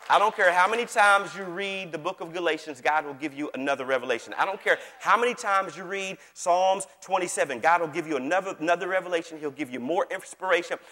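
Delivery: rousing preaching. Real, not acted. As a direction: build insistently with punched phrases.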